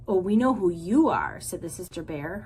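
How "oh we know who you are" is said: dripping sarcasm. A narrator's reading. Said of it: "her voice dripping with sarcasm".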